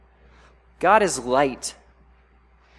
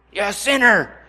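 higher, accusing voice leaning on "sinner"; "sinner" hit with a falling accusation; self-condemning scold; harsh inner critic; 5 semitones higher, 3 dB louder than usual. Retold or imitated. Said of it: "beat ourselves".

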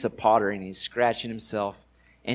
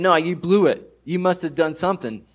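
blaming, louder and higher; accusing; 6 semitones higher, 6 dB louder than usual. Retold or imitated. Friends blaming the suffering Job, loud accusing tone. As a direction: jab the finger, tell him he blew it.

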